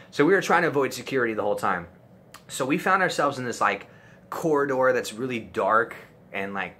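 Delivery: ordinary delivery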